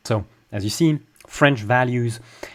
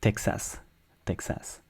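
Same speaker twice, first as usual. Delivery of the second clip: Texas as tek-sas, even syllables, softer; flat French stress; 10 dB quieter than usual. Shown as a demonstration. Teacher shows French lack of word stress, flat Texas. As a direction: say Texas softly with no syllable punched. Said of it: "in a way that's kind of flat".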